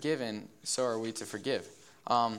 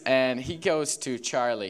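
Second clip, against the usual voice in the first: ordinary talk, nothing marked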